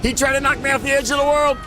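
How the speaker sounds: very high, loud outraged yells; shocked scream. Real, not acted. Player shouting after invader knocks him off the edge, shocked screams. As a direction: scream in disbelief as you get killed.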